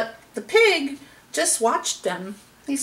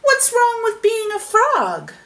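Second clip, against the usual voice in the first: offended frogs; 11 semitones higher, 3 dB louder than usual. A narrator's reading. Frogs objecting to the parrot's remark, high indignation. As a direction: rise to a high, protesting question. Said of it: "all the frogs said".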